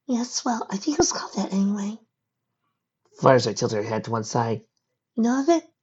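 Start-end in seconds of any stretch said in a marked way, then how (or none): 0.1-2.0 put-on young filly voice. Fireside's voice. 5.1-5.7 put-on young filly voice. Fireside's voice.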